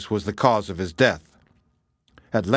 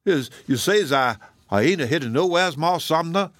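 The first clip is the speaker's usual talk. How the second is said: put-on old cook's Southern dialect, higher and questioning; doubtful; 5 semitones higher than usual. A narrator's reading. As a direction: ask uncertainly, as if disbelieving. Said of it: "asked the Negro doubtfully".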